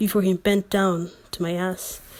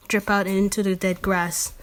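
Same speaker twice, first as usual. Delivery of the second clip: plain reading voice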